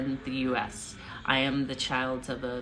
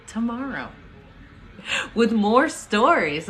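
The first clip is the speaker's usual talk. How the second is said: excited; 6 semitones higher, 6 dB louder than usual. Real, not acted. Sign-off, drawn out.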